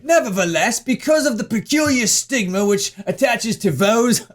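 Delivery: hammed-up British newsreader imitation; British accent attempt. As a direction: try a lofty British accent. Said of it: "me doing a British accent".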